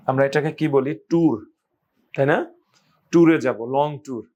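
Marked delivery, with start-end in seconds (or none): none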